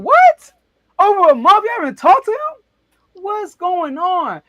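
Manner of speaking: high, loud burst of disbelief; retold shock. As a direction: blurt it out like you can't believe it. Shocked they haven't talked.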